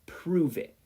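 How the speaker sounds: put-on high, loud childish dare; daring kid voice. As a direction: challenge him like a cocky kid. Boy daring his friend.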